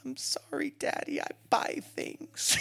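high, whispery mock-crying child; tearful little daughter. Five-year-old apologises to dad with crocodile tears, mock tearful whimper. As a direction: whimper a slow, teary apology. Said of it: "big old crocodile tears form".

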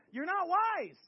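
loud, high burst of rebuke; emphatic outburst. Real, not acted. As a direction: shout the verdict at them. Same verdict repeated as an outburst.

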